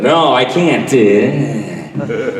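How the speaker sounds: a hint of a loud, pausing radio drawl; lightly voiced self-important DJ; musing announcer. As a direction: muse aloud like your voice is precious. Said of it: "like they were musing".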